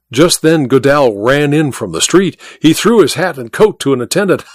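ordinary delivery, nothing marked

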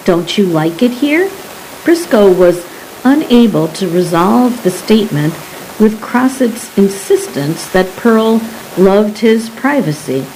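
ordinary delivery

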